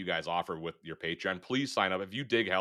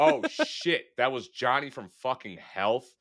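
sudden high "Oh, shit" then a slower dawning; realizing the caller was Johnny from Health; shocked realization; 6 semitones higher, 5 dB louder, slower than usual. Retold or imitated. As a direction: gasp it as it sinks in.

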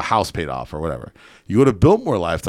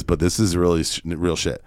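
ordinary talk, nothing marked

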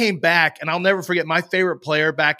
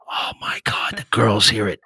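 put-on hushed whisper in a Boston accent; whispered Boston drunk; 6 semitones lower than usual. [name] whispering on the drunk voicemail in a Boston accent. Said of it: "this thick Boston".

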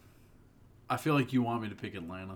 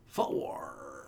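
low, drawn-out growly FOUR; deep exaggerated echo of FOUR; mocking imitation of FOUR; 8 semitones lower than usual. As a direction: mimic an over-the-top announcer's long FOUR. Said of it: "say four like that".

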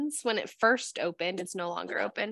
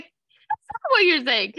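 slow, high, loud correction; acted-out exasperated friend; mock exasperation; 9 semitones higher, 6 dB louder, slower than usual. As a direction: drag it out in comic frustration.

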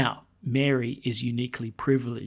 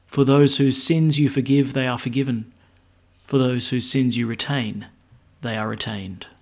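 louder formal gospel reading; gospel proclamation; 5 dB louder than usual. Acted.

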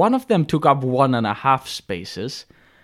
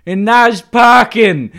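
loud, slow, high mock Brooklyn snarl; gruff Brooklyn accent; 9 semitones higher, 12 dB louder, slower than usual. Angry driver sneers at the narrator, loud slow Brooklyn accent. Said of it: "with a thick Brooklyn accent".

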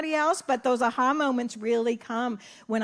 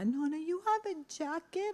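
put-on soft, higher little-boy voice; little grandson; 5 semitones higher, 7 dB quieter than usual. Grandson asking Nana about her jacket, gently child-voiced. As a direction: ask like a worried little boy.

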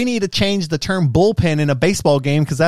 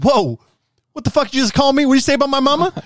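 much higher, louder mock outrage; offended alien; 12 semitones higher, 4 dB louder, faster than usual. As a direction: take offense like a street tough. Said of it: "And you're like".

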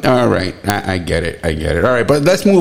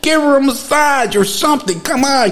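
ordinary talk

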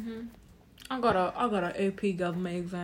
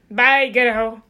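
loud bye; 14 dB louder, slower than usual. Real, not acted. Loud goodbye.

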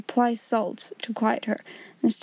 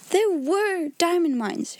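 slow, higher, emphatic assertion; stout insistence; 7 semitones higher, slower than usual. A narrator's reading. Ermengarde corrects the doubting pupils, stoutly insistent. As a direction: draw it out firmly, pitched up. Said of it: "she said stoutly".

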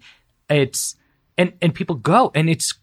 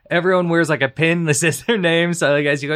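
usual speaking voice

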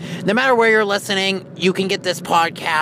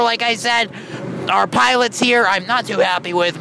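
ordinary talk, nothing marked

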